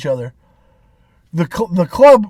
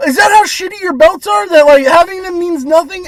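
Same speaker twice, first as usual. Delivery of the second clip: higher and louder, incredulous; incredulous rant; 11 semitones higher, 6 dB louder than usual. Real, not acted. Fan mocking Booker T's wrestling commentary, loud disbelief. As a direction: scoff loudly at the commentary.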